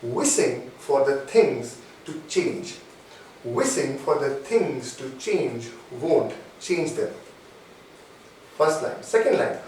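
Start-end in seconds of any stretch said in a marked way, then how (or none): 0.0-7.1 slow, low dictation with spaced-out phrases. Real, not acted. Coach dictates key points for listeners to write down, very slowly. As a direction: speak slowly enough to write down. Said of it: "I'll speak very slowly".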